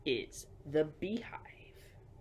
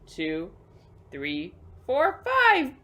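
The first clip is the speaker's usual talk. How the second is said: building count; 8 dB louder, much slower than usual. Acted. Counting the bees out for the kids, rising to an excited burst.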